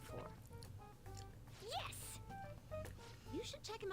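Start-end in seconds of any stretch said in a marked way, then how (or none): 1.6-2.2 excited. Acted. Sudden triumphant outburst.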